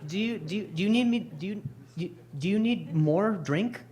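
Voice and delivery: high, repeating stuttered words; stuttering. Retold or imitated. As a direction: stammer over the question. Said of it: "I'm, like, stuttering out so hard".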